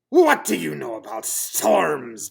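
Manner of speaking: loud, gruff demand. Acted. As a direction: bark it down at the little bunny.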